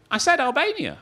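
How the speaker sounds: high, pointed repeat of the country; insistent judge. Retold or imitated. Judge at the ceremony insisting the crowd cheer. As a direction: prod the room to respond. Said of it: "She goes".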